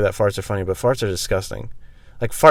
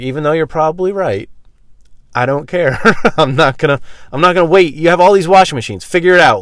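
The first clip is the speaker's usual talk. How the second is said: defiant; 6 semitones higher, 7 dB louder than usual. Retold or imitated. Retold defiance, louder.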